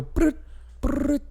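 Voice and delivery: high, clipped brut brut like a buzzing phone; imitated phone buzz, squeaky and high; phone buzz imitation. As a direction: mimic the old phone's weird buzz. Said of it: "it would just kinda go".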